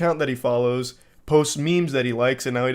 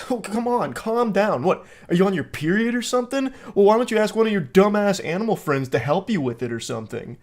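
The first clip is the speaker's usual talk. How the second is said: high-pitched goading; mock condescending guy; 6 semitones higher than usual. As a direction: imitate a dismissive, needling texter. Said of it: "he's so aggressive with everything".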